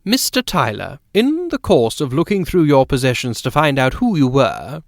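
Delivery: a hint of a chatty, insistent lady of the house; genteel hostess talking over her guests; lightly voiced society lady. A narrator's reading. Narrator voices Mrs Fortune.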